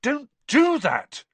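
loud, slow startled cry. A narrator's reading. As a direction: shout it out in shock. Startled Harry scolds the elf.

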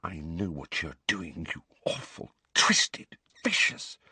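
seething, hushed accusation; furious whisper. A narrator's reading. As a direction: spit it out in a whisper. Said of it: "she whispered".

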